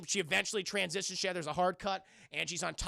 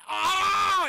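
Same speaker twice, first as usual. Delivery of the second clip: high, loud, held scream; mock death scream; 12 semitones higher, 9 dB louder than usual. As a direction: scream out long in comic agony. Victim ripped in two.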